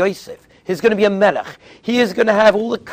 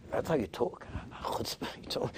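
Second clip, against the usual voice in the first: barely audible, dropping into a whisper; hushed, disapproving aside to oneself; muttered inner thought; 6 semitones lower, 19 dB quieter than usual. Retold or imitated. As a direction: mutter it under your breath. Voicing rav's private disapproving thought.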